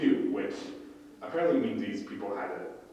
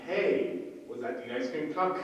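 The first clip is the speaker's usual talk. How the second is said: lightly voiced stoned guy, raised pitch; higher, rising question from a stoner; high as a kite; 7 semitones higher than usual. Shirtless stoned guy asks two passersby, high as a kite. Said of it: "high as a kite, asking".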